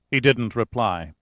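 ordinary delivery

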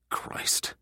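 whispered, regretful exhale; hushed oath. A narrator's reading. Overholt realises he insulted Juan, whispering. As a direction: let the word out under the breath.

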